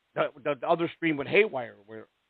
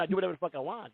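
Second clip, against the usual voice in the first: ordinary talk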